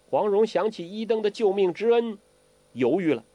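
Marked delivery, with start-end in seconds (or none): none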